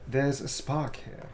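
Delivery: spoken while writing it out; slow dictation. Real, not acted. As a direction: stretch each word slowly and quietly. Writing out the quote slowly.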